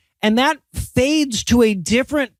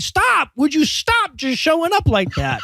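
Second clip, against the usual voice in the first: higher-pitched mock exasperation; fed-up heckle; exasperated; 6 semitones higher, slower than usual. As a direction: plead with the film to stop. Annoyed viewer addressing the screen.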